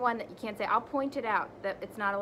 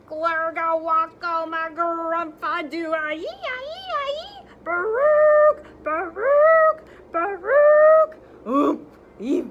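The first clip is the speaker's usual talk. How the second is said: high, loud gibberish wails; silly sounds; 9 semitones higher, 13 dB louder, much slower than usual. Acted. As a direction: go wild with nonsense noises. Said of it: "makes silly sounds like".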